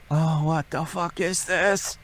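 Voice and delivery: slow, high, hushed dread; retold dread. Man replaying his approach to the shaking closet, slow soft dread. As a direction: draw it out softly in a high, uneasy voice.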